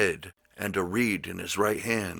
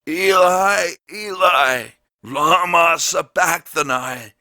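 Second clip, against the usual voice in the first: anguished shout, much higher and louder; loud cry; 9 semitones higher, 9 dB louder, slower than usual. A narrator's reading. Jesus crying out to God from the cross, loud anguished shout. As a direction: cry out to heaven with all your voice. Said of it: "cried with a loud voice".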